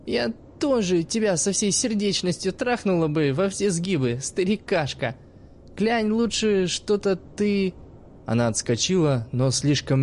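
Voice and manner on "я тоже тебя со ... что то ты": put-on higher, lively young woman telling a story; sly teenage girl talking to the old man; cheeky girl's voice. Girl insults the old teacher.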